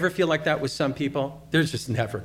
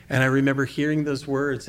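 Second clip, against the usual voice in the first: ordinary talk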